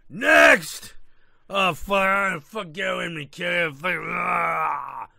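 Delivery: put-on loud, high, gruff old prospector shout; grizzled prospector. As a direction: bark like a cranky old miner. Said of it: "talking like a grizzled nine-, eighteen nineties prospector".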